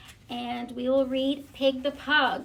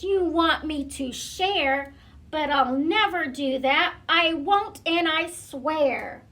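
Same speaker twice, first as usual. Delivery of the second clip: tantrum; 3 dB louder than usual. Acted. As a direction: whine and stamp like a spoiled pug refusing to share. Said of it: "He's throwing a little fit there".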